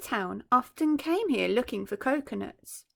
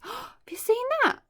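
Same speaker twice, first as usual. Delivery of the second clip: high astonished gasp, then the question; gasping in surprise; 13 semitones higher than usual. Real, not acted. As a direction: catch your breath at the trick. Reader gasps at the trick.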